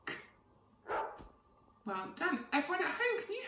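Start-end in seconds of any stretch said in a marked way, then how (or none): 0.0-0.1 K as a bare "k" sound, no vowel; isolated letter sound. Shown as a demonstration. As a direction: model only the k sound of K. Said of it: "have a go at saying".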